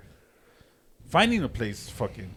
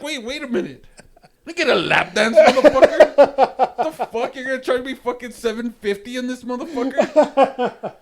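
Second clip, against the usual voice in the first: very high, loud comic protest; indignant outburst; 10 semitones higher, 9 dB louder than usual. Retold or imitated. Customer balking at strip club ATM fees, indignant. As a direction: rant it high, in outraged disbelief.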